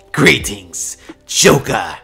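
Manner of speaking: theatrical villain greeting; Joker greeting. Acted. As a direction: announce yourself with a drawn-out flourish. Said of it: "as he would say".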